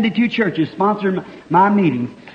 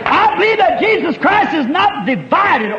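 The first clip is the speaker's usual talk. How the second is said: full-force preaching; 8 semitones higher than usual. Acted. Preacher shouting unity to the congregation. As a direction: preach it out with everything.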